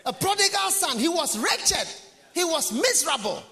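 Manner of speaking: preaching at full force. Acted. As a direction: cry out each word of ruin.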